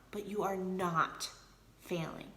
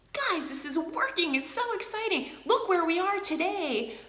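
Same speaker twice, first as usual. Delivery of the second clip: much higher, bubbly cheerleader voice to her team; excited team pitch; 10 semitones higher than usual. Retold or imitated. Coach to her team, rallying them, much higher and excited. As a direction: pitch it way up like rallying your girls.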